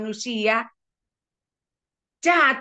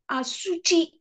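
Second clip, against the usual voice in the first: ordinary talk, nothing marked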